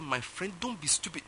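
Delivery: ordinary delivery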